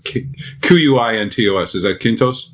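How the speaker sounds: ordinary talk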